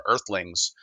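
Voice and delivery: ordinary talk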